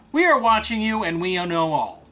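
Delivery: usual speaking voice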